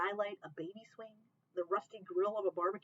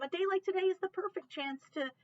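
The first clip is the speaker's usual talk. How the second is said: eager pitch; 11 semitones higher than usual. Acted.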